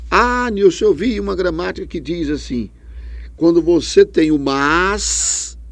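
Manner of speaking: a hint of a student citing a grammar; imagined student. Teacher voicing a student's claim, mildly eager.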